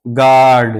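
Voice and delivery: गार्ड as one syllable, no U glide; silent U. Shown as a demonstration. Teacher introduces guard to learners, गार्ड with silent U. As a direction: say गार्ड straight from G to the long vowel. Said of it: "ये यू silent है".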